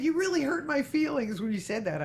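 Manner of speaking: high, whiny imitation of wounded feelings; hammed-up wounded protest; mock hurt. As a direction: play up the injured complaint. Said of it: "My feelings were really hurt".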